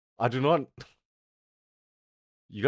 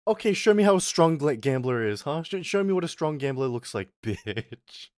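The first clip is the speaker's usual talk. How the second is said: higher, louder taunt at chat; mocking loud challenge; 6 semitones higher, 5 dB louder than usual. Real, not acted. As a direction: dare them, voice rising. Taunting chat to show strength.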